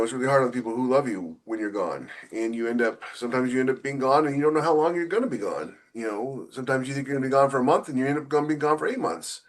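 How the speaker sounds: normal talking voice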